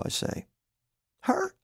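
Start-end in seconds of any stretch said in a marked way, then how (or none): 1.2-1.5 scoffing disbelief. Acted. Wife scoffs.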